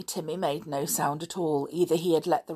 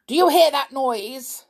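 sharp snap, higher and louder; snapping; 9 semitones higher, 8 dB louder than usual. A narrator's reading. Stepfather questioning the children about a noise, snapping. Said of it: "snapping out the words".

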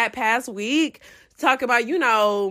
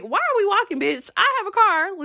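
higher, exasperated scolding; 9 semitones higher than usual. Retold or imitated. Scolding her friend in the parking lot, high and exasperated. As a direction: tell your friend off for being silly. Annoyed at walking around.

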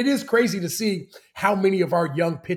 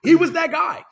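high, loud shouted agreement; emphatic excitement; 11 semitones higher, 12 dB louder, slower than usual. Real, not acted. Co-host backing the story, excitedly emphatic. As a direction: shout it out, totally convinced.

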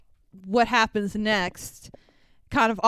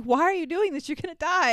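higher, mildly pleading voice; retold crying; 6 semitones higher, faster than usual. Rocket begging Groot not to die, retold crying. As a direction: plead as a tearful Rocket, lightly acted. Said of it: "he starts crying and being like".

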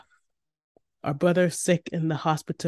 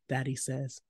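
ordinary delivery, nothing marked

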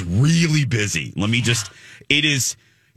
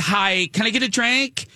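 high, sweet mock bride voice; 11 semitones higher than usual. Bride squeezing in at the bar, high and sweet. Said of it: "And she says".

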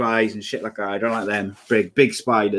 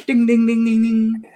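bell imitation; 12 semitones higher than usual. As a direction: ring the bullshit bell. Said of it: "Bullshit bell".

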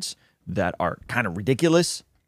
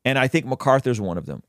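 ordinary delivery

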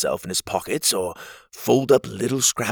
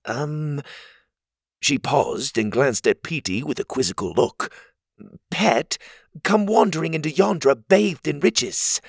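a higher, teasing, lightly voiced girl; wry Cogleen; 6 semitones higher than usual. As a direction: gently needle the hero.

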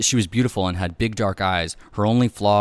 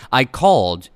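loud, higher defensive yell; yelling back; 5 semitones higher, 10 dB louder than usual. A narrator's reading. Late husband defends himself to wife, yelling back. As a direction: throw it back at full volume. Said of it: "he yelled back".

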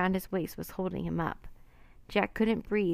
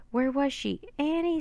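screaming her name; 7 semitones higher than usual. Acted. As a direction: search for your sister in panic. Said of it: "screamed Jack".

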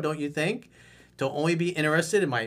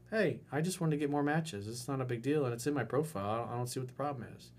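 softer, unbothered, easy delivery; calm and collected; 7 dB quieter, faster than usual. Shown as a demonstration. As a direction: keep it cool, nothing to prove. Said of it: "you gotta be calm, cool, collected".

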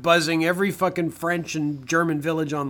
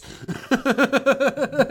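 long, high giggling cackle; high laughter; 9 semitones higher than usual. Real, not acted. Podcaster laughing at his own impression, high giggles. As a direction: crack up in quick high bursts.

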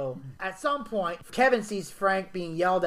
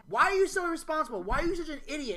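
mock scolding; 8 semitones higher, faster than usual. Mimics tame TV scolding.

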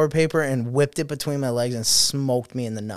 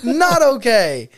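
startled scolding; 11 semitones higher, 7 dB louder, much slower than usual. Retold or imitated. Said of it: "And I go".

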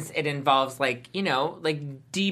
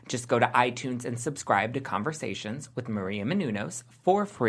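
ad read; 5 semitones lower, 3 dB quieter than usual. Acted. Hosts read a podcast ad.

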